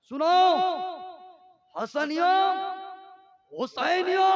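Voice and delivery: loud rising call to the crowd. Real, not acted. Preacher calling to his listeners, loud call. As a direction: call the names out to the whole hall.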